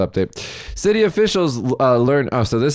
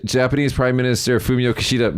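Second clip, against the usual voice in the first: normal talking voice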